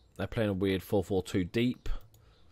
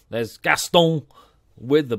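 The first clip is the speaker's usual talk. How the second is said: Gaston in a playful put-on French accent, louder and higher; mock French accent; 4 semitones higher, 8 dB louder, slower than usual. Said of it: "you have to say it with an accent".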